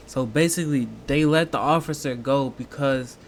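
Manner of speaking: ordinary talk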